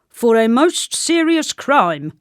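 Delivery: slow, loud, grave official voice; soldier. A narrator's reading. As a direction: pronounce the charge slowly and weightily. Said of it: "replied the soldier".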